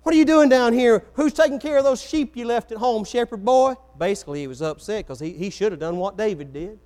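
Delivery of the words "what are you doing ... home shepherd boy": put-on higher, louder scolding older brother; a big brother snapping at the boy; retold angry brother. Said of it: "his brother got mad and said".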